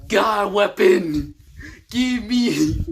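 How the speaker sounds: high, loud, drawn-out cries; desperate shouting. Acted. Hero having a breakdown over his weapon, desperate shout. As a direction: break the demand into long yells.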